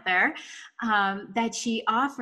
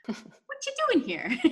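squealing laugh; 12 semitones higher than usual. Real, not acted. Host laughing at guest's question.

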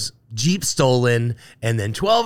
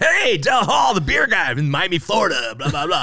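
radio host; 7 semitones higher than usual. Retold or imitated. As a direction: shout it like a pumped-up radio host. Miami radio host calling.

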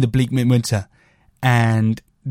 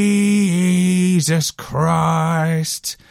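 very slow, drawn-out exasperated Jesus Christ; hammed-up annoyed exclamation; mock cross curse; 3 semitones higher, much slower than usual. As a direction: stretch it out like a fed-up swear. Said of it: "as if they're really pissed off".

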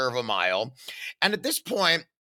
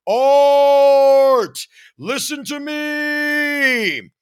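very loud, high, slow call from the diaphragm; long held theatrical shout; projected shout; 11 semitones higher, 9 dB louder, much slower than usual. Retold or imitated. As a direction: project it like a stage actor. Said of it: "Use your theater training".

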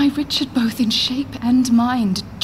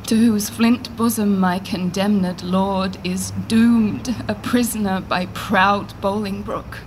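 ordinary talk

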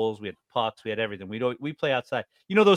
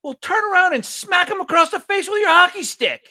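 high, loud mock hockey mom egging her son on; pushy hockey mom; 6 dB louder than usual. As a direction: play the neighbor's mom shouting at her boy. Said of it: "the mom says to the kid".

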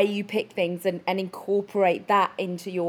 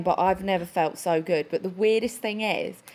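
ordinary delivery

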